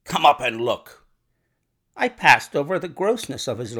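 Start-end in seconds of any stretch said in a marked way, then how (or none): 0.0-1.0 loud, agitated order; agitated demand. A narrator's reading. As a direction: order it loudly, still worked up. Panicked lodger still yelling.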